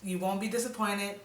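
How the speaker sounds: normal talking voice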